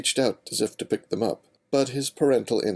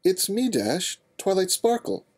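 much higher, pleading; young mare voice; 12 semitones higher than usual. Acted. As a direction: insist on who she is. Twilight pleads.

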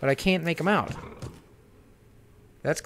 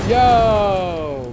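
sudden loud yell; loud surprised yo; startled shout; 3 semitones higher, 8 dB louder than usual. Real, not acted. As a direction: shout in shock.

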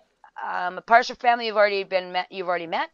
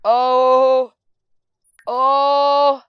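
loud groan; 3 semitones higher, 11 dB louder than usual. Real, not acted. Reacting to game event.

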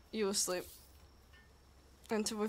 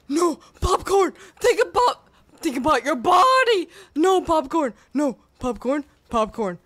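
desperate yelling; 3 semitones higher, 15 dB louder than usual. Real, not acted. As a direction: yell in panic to stop them. Pleading with pal not to eat.

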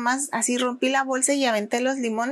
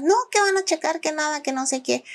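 protesting victim; 5 semitones higher than usual. Retold or imitated. Fake victim protesting cameras.

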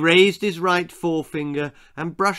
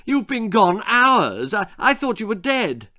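relieved cry; 7 semitones higher, 3 dB louder than usual. A narrator's reading. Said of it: "he cried".

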